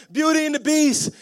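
put-on high, loud kid voice; young son's joke. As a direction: imitate a little boy proudly announcing it. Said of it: "He's like".

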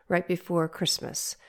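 usual speaking voice

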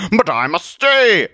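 high, loud, slow heroic accusation; indignant Zealot. Acted. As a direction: denounce a villain with grand outrage. Zealot refuses to leave.

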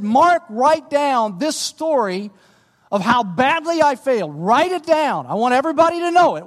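preacher voicing an emphatic, confessing Peter; urgent command. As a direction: press it home forcefully, building in pitch. Peter urgently dictating his failure.

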